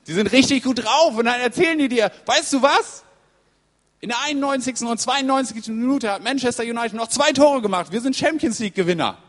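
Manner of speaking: loud, high football-fan elation; wildly excited. Acted. Mates telling a friend about late goals, wildly excited. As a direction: burst out with the unbelievable match news. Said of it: "die drehen alle durch".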